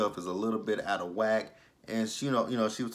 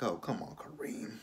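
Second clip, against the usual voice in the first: softer, trailing off to a whisper; whispered aside; 8 dB quieter than usual. Real, not acted. Vlogger begs the character under her breath, whispering. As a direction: breathe it out like a private plea.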